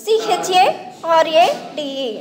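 letters spelled out one by one, slowly; slow spelling out. Shown as a demonstration. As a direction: read the letters slowly for the class. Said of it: "ఇక్కడ షెరాడ్".

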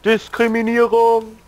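drawn-out high outraged cry; mock outrage. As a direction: wail the word in fake indignation. (gespielte Empörung)

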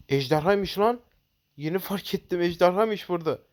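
startled gasp, then a high rush; suddenly spotting the dragon; surprised. Real, not acted.

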